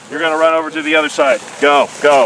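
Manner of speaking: loud urging call; calling from far away. Real, not acted. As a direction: push them to run, loudly. Urging team to run across.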